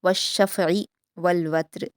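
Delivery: slow, melodic Quranic recitation; measured Quran recitation; tajweed recitation. Shown as a demonstration. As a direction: recite it slowly and carefully as a model for students. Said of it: "आइन के मखरज को अच्छे से अदा करेंगे हलक से".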